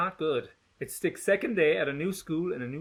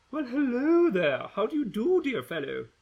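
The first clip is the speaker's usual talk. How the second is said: posh fake politeness; 9 semitones higher than usual. Gretchen fake polite greeting.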